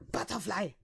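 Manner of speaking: harsh, hammed-up angry delivery; mock angry. Shown as a demonstration. Proving any English word can sound angry, mock angry. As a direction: fake fury on one harmless word. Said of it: "you can make everything sound angry".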